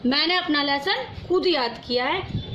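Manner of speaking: usual speaking voice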